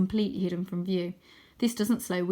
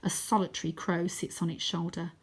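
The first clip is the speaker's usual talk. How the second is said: plain reading voice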